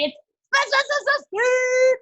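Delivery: loud stuttered "spa" into a high, drawn-out "speed"; energetic, not bored. Shown as a demonstration. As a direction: hit each "spa" hard, then shout "speed". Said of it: "It's not speed".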